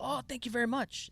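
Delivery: high-pitched, courteous thanks; imitated polite local. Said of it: "In fucking perfect English".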